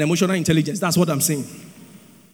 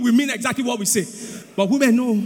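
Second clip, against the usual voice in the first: ordinary talk, nothing marked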